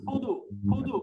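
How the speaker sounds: ফুদু started with both lips pressed shut; lips together. Shown as a demonstration. Teacher corrects ফুদু for the class, lips pressed together. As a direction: close the lips fully for the ফ in ফুদু. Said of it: "দুইটা ঠোঁট লাগাই বলেন ফুদু".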